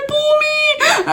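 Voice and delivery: high, crying plea; tearful plea. Retold or imitated. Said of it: "got to that door and said".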